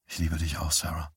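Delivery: soft, low-key love reply; tender. A narrator's reading. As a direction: answer softly and intimately. Lover answers whispered love confession.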